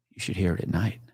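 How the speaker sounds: low, hushed, ominous line; quiet, chilling understatement; eerie warning. Retold or imitated. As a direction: say it low, like a dark secret. Kid's eerie warning, retold.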